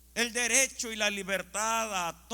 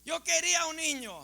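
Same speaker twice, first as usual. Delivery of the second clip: higher, mildly wailed protest; mock upset cry; 6 semitones higher than usual. Preacher retelling a mother's gender-reveal tantrum, mock upset cry. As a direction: imitate a woman crying out in anger. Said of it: "comienza a gritar, a llorar y a enojarse".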